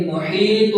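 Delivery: محیط chanted with the ending hummed through the nose; ghunnah. Shown as a demonstration. Teacher models the word, محیط with a held nasal ending. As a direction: hold the final "m" sound of محیط. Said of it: "اب اس پہ گننا بھی کرنا".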